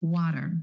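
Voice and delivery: "water" with the T flapped like a quick D. Shown as a demonstration. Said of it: "Do you hear that flap T or the D sound?".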